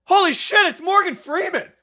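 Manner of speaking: imitating a friend's starstruck shout; excited shout. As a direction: blurt it out like you just spotted a celebrity. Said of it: "I hear one of my friends go".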